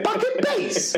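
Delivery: very high laughing disbelief; incredulous echo. Retold or imitated. Teacher questioning his colleague's army talk, very high incredulous echo. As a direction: squeak the phrase back in disbelief. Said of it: "I looked at him, I went".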